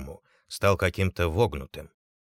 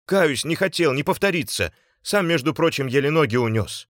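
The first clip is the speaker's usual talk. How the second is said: high, raised, flustered apology; agitated repentant plea; pleading; 7 semitones higher, 5 dB louder than usual. A narrator's reading. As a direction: beg to be let off. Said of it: "взмолился Артём".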